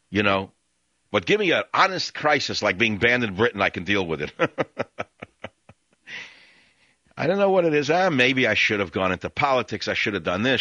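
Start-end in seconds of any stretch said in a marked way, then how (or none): 4.4-5.5 short run of high chuckles; real chuckle. Real, not acted. Host joking about being banned in Britain, chuckling. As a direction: chuckle at your own troubles.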